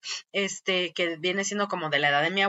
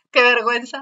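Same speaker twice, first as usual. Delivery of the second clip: laughing; 2 semitones higher, 4 dB louder than usual. Real, not acted. Laughing after her own sneeze.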